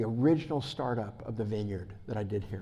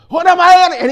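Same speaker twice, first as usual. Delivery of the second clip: shout; 20 dB louder than usual. Retold or imitated. David yells on the bus.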